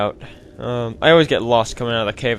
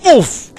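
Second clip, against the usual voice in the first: short, high, loud oof; imitated oof; 12 semitones higher, 8 dB louder than usual. Traven falls over in a fireball, imitated oof. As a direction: mimic being knocked over. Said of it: "He makes a little oof sound".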